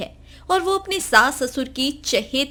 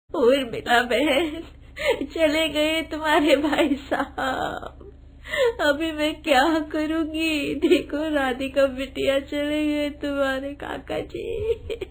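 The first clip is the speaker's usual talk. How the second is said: slow mournful wail of a widow; grieving widow; 2 semitones higher, slower than usual. Acted. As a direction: drag out the grief. Widow mourns her husband. (विधवा का धीमा विलाप)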